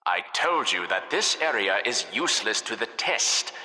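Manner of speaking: normal talking voice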